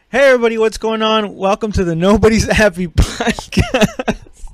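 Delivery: high, loud host greeting cracking into laughter; laughing intro. Real, not acted. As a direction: belt out the welcome and lose it laughing. Podcast intro breaking into laughter.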